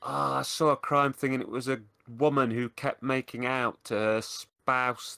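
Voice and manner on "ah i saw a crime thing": usual speaking voice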